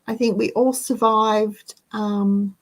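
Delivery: ordinary talk, nothing marked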